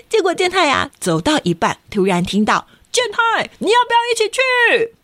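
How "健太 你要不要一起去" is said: high, far-off call. Acted. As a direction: shout his name from across the street. Big brother calling out.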